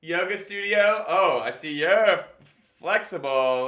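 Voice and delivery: mock gym show-off. Comic pitching his bit to the group, showy gym bragger voice. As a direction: brag to the crowd like a gym show-off. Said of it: "there was a version of this where I was gonna go".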